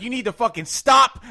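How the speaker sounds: loud, high order to a driver; reenacted road rage yell; retold angry demand. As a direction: lay down the law, heated.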